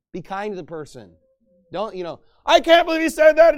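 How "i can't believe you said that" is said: loud, high outraged shout; acted burst of indignant yelling; blowing his top. Berating someone over what they said, angry shouting. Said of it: "blowing your top at someone".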